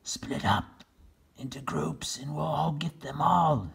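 hushed whispered order. Acted. Captain Bill whispering orders to his men. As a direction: whisper the plan. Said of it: "whispered Captain Bill".

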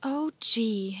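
loud cry of dismay; dismayed exclamation. A narrator's reading. Andy overloaded on his bike, dismayed exclamation. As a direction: blurt it out loudly.

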